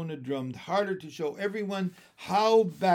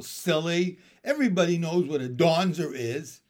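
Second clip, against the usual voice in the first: put-on scornful little girl, louder; 2 semitones higher, 7 dB louder than usual. A narrator's reading. Ramona scorns her older sister, loud and sure. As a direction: scoff at her sister for not knowing. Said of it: "Ramona was scornful".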